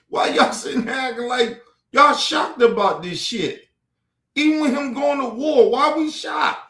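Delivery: high, loud, disbelieving yell; incredulous shouting. Real, not acted. Shouting at voters acting shocked about war, incredulous. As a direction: demand it at full voice.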